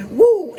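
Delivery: high, loud imitated Ric Flair woo. Mocking Ric Flair with his own woo. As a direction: belt out a Flair-style woo.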